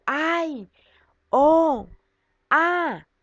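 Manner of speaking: high, drawn-out demonstrated interjections; exclamation intonation. Shown as a demonstration. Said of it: "se hace la entonación".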